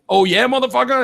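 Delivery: high threatening mock taunt; hammed-up angry mother; mock mama bear. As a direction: snarl it like an armed mom. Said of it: "Mama Bear is like".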